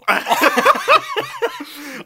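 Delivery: high, loud cackle; cackling laugh. Real, not acted. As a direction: crack up at your own dark joke. Laughing at own joke.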